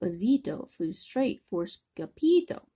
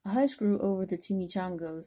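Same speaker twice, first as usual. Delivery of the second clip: usual speaking voice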